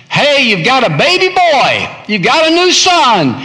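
high, bright mock announcement; cheery messenger. Preacher mocking the messenger who told Jeremiah's father, high and cheery. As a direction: sing out the good news like a herald.

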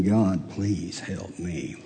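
soft, low, slowed-down prayer; hushed imitation of begging God for help; retold tearful plea. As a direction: plead quietly, as if recalling a private cry. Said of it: "just crying, saying".

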